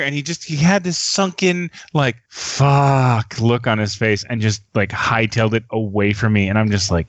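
usual speaking voice

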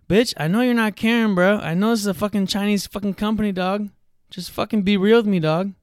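loud, high, exasperated rant at the company; angry rant. Real, not acted. Ranting at a fake Karen support rep, furious and loud. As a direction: yell at them to just be honest.

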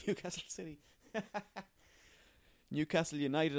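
giggling through the club name; laughing at own slip. Real, not acted. Podcaster laughing at his own wrong club name. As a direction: laugh it off and correct yourself. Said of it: "I meant to say".

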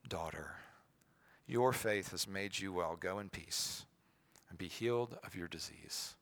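soft, low, compassionate; tender. Acted. Jesus reassuring the healed woman, soft and tender. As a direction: speak gently, with deep tenderness. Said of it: "There's such tenderness in his voice".

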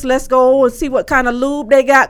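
loud, high, eager coaxing; eager hype. Retold or imitated. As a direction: pump him up, let's go. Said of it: "I was like".

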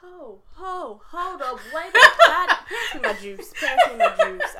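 high, loud, laughing disbelief; laughing shock. Real, not acted. Hearing Morgan Freeman gossip, loud laughing shocked outburst. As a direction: gasp and laugh at the scandal.

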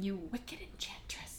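hushed, acted insult; venomous whisper. Fifi insulting rival Belle, venomous whisper. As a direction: whisper the insult under your breath.

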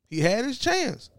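"chance" said high, falling; emphatic repeat. Real, not acted. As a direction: push "chance" up high then drop. Repeated much higher.

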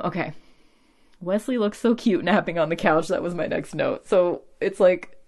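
ordinary delivery

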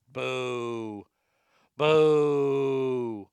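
boo slowly like an unimpressed crowd; mock booing. Comedian heckles his own bad joke, mock booing.

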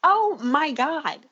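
high, slow, overcome "oh my God"; emotional gush. Retold or imitated. Fan retelling crying at the show, high slow overwhelmed gush. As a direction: sound moved to tears by the scene. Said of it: "And I was like".

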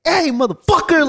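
high-pitched mock yell; retold yelling. Fan confronting a scalper for his friend, retold yelling. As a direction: re-enact yelling in someone's face, pitch shooting up. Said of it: "I was yelling at his face".

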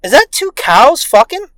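loud, high-pitched heckle; mock incredulous question. Onlooker in alley at neighbors, mock incredulous. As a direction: call it out in fake bewilderment.

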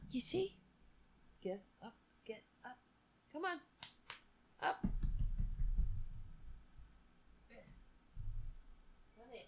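high, soft coaxing pet talk. Real, not acted. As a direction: sweet-talk the cat up to you. Coaxing cat onto the bed.

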